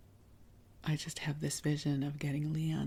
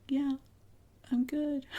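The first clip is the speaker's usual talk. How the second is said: much higher, mock yell back; retold yell back; 11 semitones higher, 3 dB louder than usual. Daughter in the bathtub answers mom downstairs, mock yelling back. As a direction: call back as if from upstairs, half-voiced. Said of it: "I'd have to yell back".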